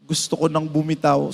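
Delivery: low, soft mock-weary gusto ko nang bumitaw; too low. Pastor imitating a crying churchgoer, low and soft. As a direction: let gusto ko nang bumitaw sink low and quiet like a tired plea. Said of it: "Parang mababa, itaas mo ng konti".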